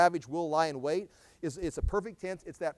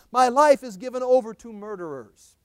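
fiery preaching; 3 semitones higher, 12 dB louder than usual. Acted. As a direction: thunder the prophet's lament at them. Preaching scripture at full force.

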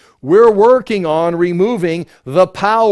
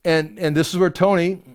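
ordinary talk, nothing marked

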